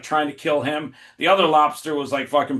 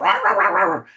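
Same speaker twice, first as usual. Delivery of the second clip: loud, higher "rah" barks; imitated dog barking; 5 semitones higher, 5 dB louder than usual. Copying his dog barking at the oven, loud mock barks. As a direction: bark it out like a riled-up dog. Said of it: "He's barking".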